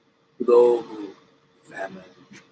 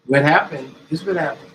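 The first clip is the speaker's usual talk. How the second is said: higher, louder demand; loud challenge; 4 semitones higher, 10 dB louder than usual. Acted. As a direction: confront them loudly. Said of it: "mock challenge".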